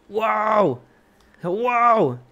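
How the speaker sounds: high, loud wow; amazed. Real, not acted. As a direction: gasp in awe. Amazed at view.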